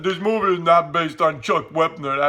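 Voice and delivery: a light mumbled Stallone; lightly voiced Sylvester Stallone denying it; a hint of a Stallone voice; Stallone impression. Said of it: "and Sylvester Stallone".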